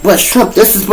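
a hint of a pleading cop, pitched high. Cop pleading in retold argument.

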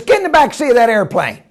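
suddenly keen, hurry him aboard; eager order. Acted. Owner offered a hundred dollars, eager order. Owner abruptly agrees for money.

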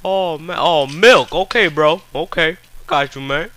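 mocking the other player as a dumb cheater. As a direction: play a clueless cheater reading console codes. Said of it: "Should've been like".

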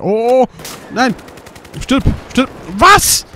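loud, high shouting in a gunfight; real gaming shout. Real, not acted. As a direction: scream at the screen in the heat of the fight. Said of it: "Ich kann grad hier nicht so rumschreien". (lauter, hoher Schrei mitten im Gefecht)

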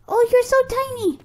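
high cooing at a tiny creature. Real, not acted. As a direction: coo over the tiny hatchling. Cooing at baby dragon.

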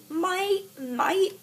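put-on Australian "mate"; Australian accent. Shown as a demonstration. Vlogger testing an accent on "mate", Australian. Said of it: "that's Australian".

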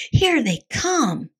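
high, drawn-out warning call; slow, high cartoon holler. A narrator's reading. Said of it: "hollered Scapito".

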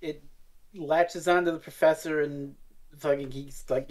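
ordinary talk

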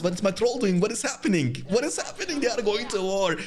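frantic what-is-happening; real alarm. Real, not acted. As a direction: voice climbing as things go wrong. Watching his troll charge off.

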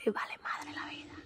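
unvoiced mock whisper for the toddler; whispered voice for the child. Mother voices her daughter in a whisper. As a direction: breathe it like the bored kid.

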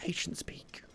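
breathy whispered echo. Pretend. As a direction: hush it like a secret.

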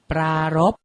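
ปรารภ said carefully as pra-rop; careful teaching read of ปรารภ; pronunciation model. Shown as a demonstration. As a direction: model ปรารภ with a long first syllable. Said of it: "อ่านว่า".

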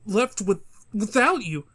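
halting, stuttered excuse; nervous stammer. Acted. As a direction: trip over first sounds, restarting and pausing.

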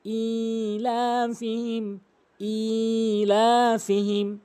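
ইলাফিহিম with a long held opening ee; slow chant, "eee-laa-fihim"; correct elongation. Shown as a demonstration. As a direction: stretch the first vowel of ইলাফিহিম. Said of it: "টেনে পড়তে হবে".

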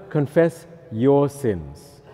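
YOUR drawn out and emphatic; stress on YOUR. Shown as a demonstration. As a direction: confess YOUR sins, very slow.

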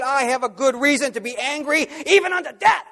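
high, loud defiance rising on even; defiant answer. Acted. Jonah answering God defiantly, high and loud. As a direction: throw the answer back, daring God.

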